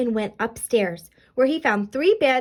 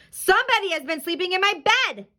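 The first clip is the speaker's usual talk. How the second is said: high-pitched, forceful bear outcry; storybook papa bear's big outcry; great big Papa Bear voice; 8 semitones higher than usual. A narrator's reading. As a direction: boom it out like an indignant big bear. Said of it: "in his great big voice".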